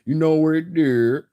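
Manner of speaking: loud, high imitation Baltimore accent. Shown as a demonstration. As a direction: put on an exaggerated Baltimore accent. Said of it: "the accent of people from Baltimore".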